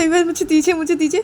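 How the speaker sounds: eager begging. Acted. Rani begging to hold baby.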